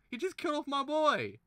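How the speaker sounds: real anguished shout; outraged cry. Real, not acted. Outraged at death.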